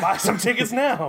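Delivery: put-on announcer voice. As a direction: sell it like a trailer. Echoes in put-on voice.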